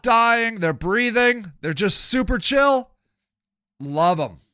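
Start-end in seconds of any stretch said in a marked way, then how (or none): none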